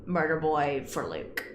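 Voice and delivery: ordinary delivery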